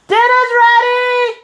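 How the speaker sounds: sung-out call up the stairs; yelling across the room. Real, not acted. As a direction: call everyone down, loud and high. Calling family down for dinner.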